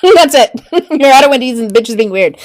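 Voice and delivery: laughing through the line. Real, not acted. To her audience, laughing at the weird elf. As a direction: cracks up at her own punchline.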